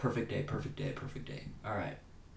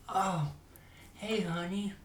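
lilting, higher lovey-dovey hello; sweet greeting to wife; 9 semitones higher, slower than usual. Retold or imitated. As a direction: coo it lightly, pitched up. Voicing himself greeting his wife.

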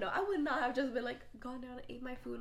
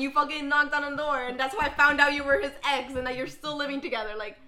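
angry rant; 2 semitones higher, 12 dB louder than usual. Pretend. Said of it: "I'm like".

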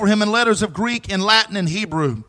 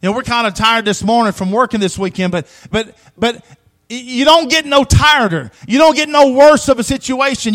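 ordinary talk, nothing marked

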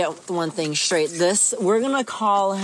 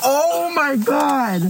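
shocked groan; 9 semitones higher, much slower than usual. Real, not acted. Screaming at a fart in car.

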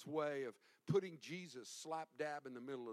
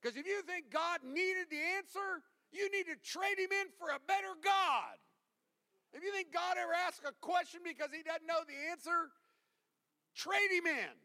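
loud, high, full-force sermon delivery; fiery preaching; 14 semitones higher, 6 dB louder than usual. Real, not acted. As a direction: hammer it home to the congregation.

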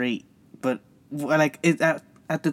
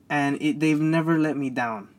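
usual speaking voice

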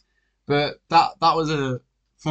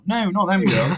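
laughing as she says no; laughing retort; 5 semitones higher than usual. Retold or imitated. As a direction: giggle through the denial. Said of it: "I'm like".

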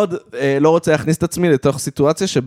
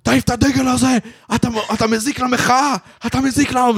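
retold yelling; 6 semitones higher than usual. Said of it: "והוא התחיל לצעוק עליו".

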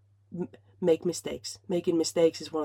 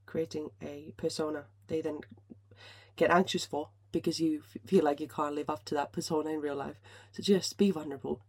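ordinary talk

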